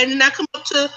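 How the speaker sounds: ordinary talk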